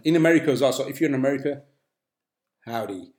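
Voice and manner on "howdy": put-on American accent. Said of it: "that's my worst American accent".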